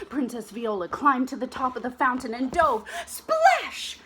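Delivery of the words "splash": loud high splash; splash sound. Acted. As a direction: make the splash big. Diving sound.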